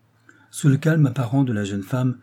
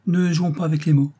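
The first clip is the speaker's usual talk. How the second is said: mildly cold rebuke, pitched higher; cool and firm, voice raised in pitch; 6 semitones higher, 4 dB louder than usual. A narrator's reading. Woman pulling back from Max, mildly cold. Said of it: "dit-elle d'un ton froid".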